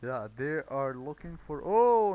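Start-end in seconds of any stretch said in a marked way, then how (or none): 1.6-2.1 a sharp, much louder and higher "oh"; sudden realization. Real, not acted. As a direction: catch your own mistake out loud. Suddenly realizes he misread the numbers.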